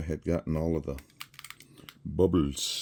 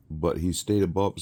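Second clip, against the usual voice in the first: usual speaking voice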